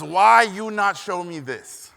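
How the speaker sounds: put-on exasperated broken English, thick Hungarian accent. Hungarian teacher scolding student for hiding paintings, loud accented demand. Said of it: "thick accent".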